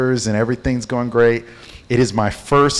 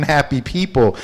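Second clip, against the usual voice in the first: ordinary talk, nothing marked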